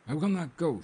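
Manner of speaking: high, loud refusal; emphatic frightened protest; distressed cry. A narrator's reading. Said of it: "she cried".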